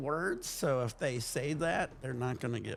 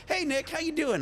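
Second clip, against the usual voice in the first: much higher, bright friendly hello; cheerful coworker greeting; 13 semitones higher than usual. Retold or imitated. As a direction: greet him like an old pal at the store. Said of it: "they'd be like".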